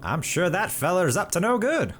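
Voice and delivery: put-on high, creaky old-timey codger; old man voice. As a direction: play a fussy old-timer. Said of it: "use my old man voice".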